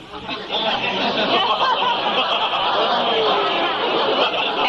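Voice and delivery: bursts into high-pitched laughter; can't hold back a high giggle. Real, not acted. Cracks up retelling story.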